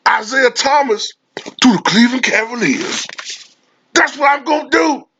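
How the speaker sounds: very high, hammed-up owner voice; a smug owner bossing his property; mock team owner. Celtics owners defending the Isaiah Thomas trade, very high mock voice. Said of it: "They said".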